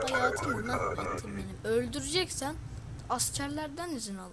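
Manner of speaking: mock angry applicant.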